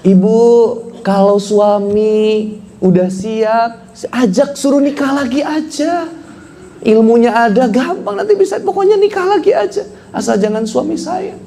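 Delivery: high-pitched imitation of a woman's voice; drawn-out, high mock-wifely voice; mock eager woman. Preacher mimics women urging him to remarry, high mock-female voice.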